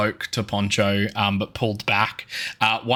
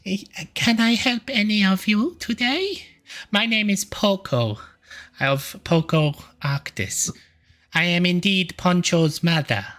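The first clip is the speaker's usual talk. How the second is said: higher, cheerful shop greeting; friendly shopkeeper penguin; 9 semitones higher than usual. Acted. Penguin mother greeting the party in her shop, higher voice. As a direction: welcome the customers brightly. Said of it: "and he's like".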